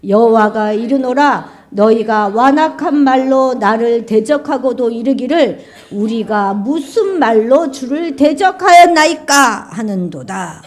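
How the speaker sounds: loud, solemn scripture read. Acted. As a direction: proclaim the verse with weight. Reads scripture aloud.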